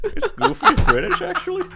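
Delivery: giggling in a high cartoon voice; laughing high voice. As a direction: laugh through a Goofy squeak. Still in Goofy voice, laughing.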